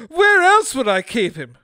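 high, loud retort. Acted. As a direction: scream it back, exasperated. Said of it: "she screamed".

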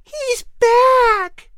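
put-on high, loud child's excited call; little girl shouting. Little sister calls out that her brother is back, high and loud. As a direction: shout it out like a five-year-old running up. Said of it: "came the voice of a little five-year-old girl".

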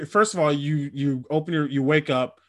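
ordinary talk

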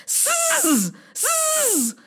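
long, sustained hissing; snake hiss. A narrator's reading. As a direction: hiss like a snake flicking its tongue. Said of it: "the tongue of the snake went".

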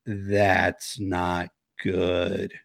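very slow, low and ominous; grave warning. Retold or imitated. Medium hearing Gemma's confession, grave imitation.

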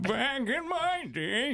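put-on high, slow, creaky old man; slow crotchety old-timer voice. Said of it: "do your old man voice".

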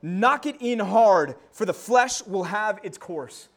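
loud then slow and steady; a martyr bravely urging the smith; calm martyr's words. Retold or imitated. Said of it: "he said".

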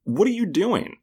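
high-pitched disbelief at a blunder; incredulous scolding. Retold or imitated. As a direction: call him out in baffled, high voice. Scolding Burns's tactless question in retelling.

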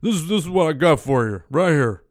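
a hint of an old man handing over a gift; imitating grandpa. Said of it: "'cause that's how he talked".

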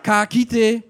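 put-on high, loud Māori auntie calling out to her boy, amused; auntie on the answer phone. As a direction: shout down the phone like a bewildered old auntie.

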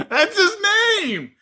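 high-pitched laughing talk. Real, not acted. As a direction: crack up while talking. Both cracking up over name.

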